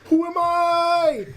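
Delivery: slow, high, dramatic movie line; Jackie Chan quote. Retold or imitated. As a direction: draw it out like a film hero. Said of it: "he goes".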